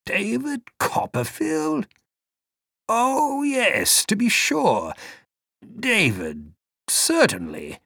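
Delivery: put-on an old man suddenly recalling, with a soft rise; remembering old man. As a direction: vague old man, soft rising recognition.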